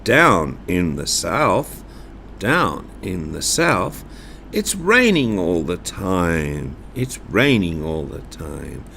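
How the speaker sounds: low south line. Shown as a demonstration. Teacher leading the poem's low south line. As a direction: drop the voice down south. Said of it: "Please say this after me".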